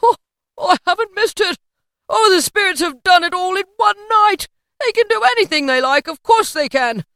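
high, loud, relieved joy; elated Scrooge. A narrator's reading. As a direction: exclaim it in breathless delight. Story figure Scrooge, elated.

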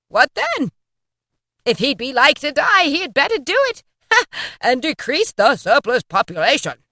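high sneering mimicry with a laugh; mocking Scrooge quote. A narrator's reading. The ghost mocking Scrooge's words, sneering and high. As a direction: throw his cold words back at him. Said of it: "his own words quoted by the spirit".

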